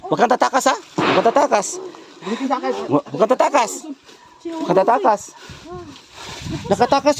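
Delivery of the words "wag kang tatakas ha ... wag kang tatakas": stern shouted order. Acted. Ordering captive not to flee.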